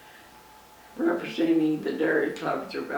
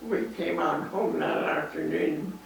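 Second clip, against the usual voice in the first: ordinary delivery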